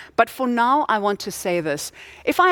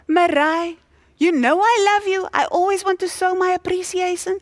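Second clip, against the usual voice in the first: much higher, louder hammed-up husband; comic husband voice; 10 semitones higher, 3 dB louder than usual. Husband reassuring his wife that he loves her, in a much higher, louder hammed-up voice. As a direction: overplay the doting husband, pitched way up.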